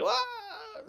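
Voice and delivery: imitating a shocked biker; shocked cry. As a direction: yelp it in disbelief. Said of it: "he's all like".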